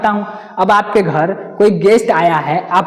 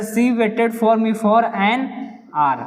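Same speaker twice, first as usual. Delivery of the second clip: slow question read; much slower than usual. Shown as a demonstration. Reads answer slowly.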